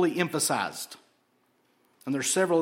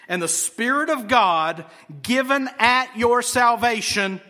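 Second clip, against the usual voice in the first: raised, louder pulpit voice; emphatic preaching; 6 semitones higher, 6 dB louder than usual. Real, not acted. Preacher proclaims the Spirit given at salvation, in forceful preaching. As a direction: proclaim it high and strong, word by word.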